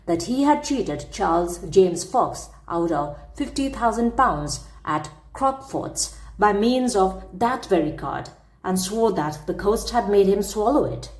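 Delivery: ordinary delivery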